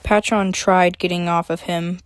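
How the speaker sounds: ordinary delivery, nothing marked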